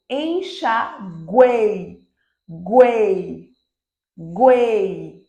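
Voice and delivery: "enxaguei" slowly, then GUEI drawn out and loud; one unbroken sound. Shown as a demonstration. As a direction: hold "guei" as one swelling, falling glide. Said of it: "é o único som, não tem pausa".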